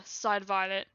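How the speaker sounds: plain reading voice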